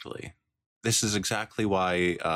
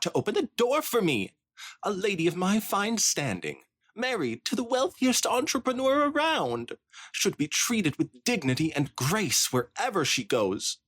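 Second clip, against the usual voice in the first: much higher, prim and indignant, with a shrill cackle; haughty high-society rant; 13 semitones higher than usual. Acted. Spoiled Rich justifying her snub, much higher and indignant. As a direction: look down your nose at everyone.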